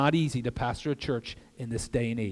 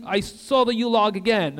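usual speaking voice

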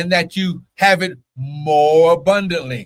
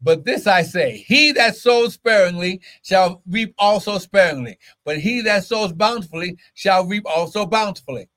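preaching at full force; 6 semitones higher than usual. Acted. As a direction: drive it home like a fired-up preacher.

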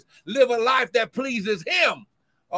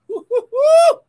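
very high, loud repeated whoops; ecstatic whooping; 12 dB louder than usual. Real, not acted. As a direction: let it erupt in joy. Ecstatic praise outburst.

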